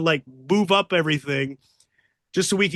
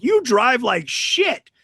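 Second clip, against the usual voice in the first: higher, drawn-out insult; mock Candido jab; 6 semitones higher, 3 dB louder, slower than usual. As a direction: sneer it slowly at the bad driver. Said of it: "Candido's like".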